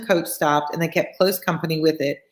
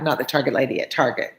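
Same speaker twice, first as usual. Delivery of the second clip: usual speaking voice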